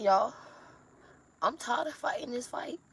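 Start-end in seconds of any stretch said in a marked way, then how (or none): 1.4-2.8 broken exhausted whisper. Real, not acted. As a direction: whisper it, worn out. Exhausted, despairing confession.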